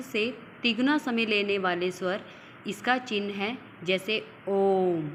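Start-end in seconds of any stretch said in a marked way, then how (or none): 2.7-5.1 ओम held extra long, three times a short vowel; ओम stretched slowly with a long held vowel; prolonged om. Shown as a demonstration. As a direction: draw out ओम slowly as a pluta vowel. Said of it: "तिगुना समय लग रहा है ओम बोलते हुए".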